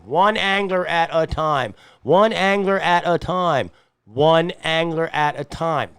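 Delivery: slow and loud, every word of "one angler at a time" punched; slow loud slogan, said three times over; campaign slogan. Shown as a demonstration. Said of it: "hear my campaign".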